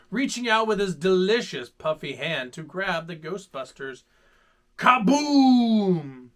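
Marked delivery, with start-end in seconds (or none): 4.8-6.3 loud boom. Acted. Explosion in story.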